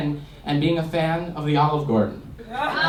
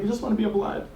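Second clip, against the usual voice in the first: little brother voice; 7 semitones higher, 6 dB quieter, faster than usual. Retold or imitated. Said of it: "He's like".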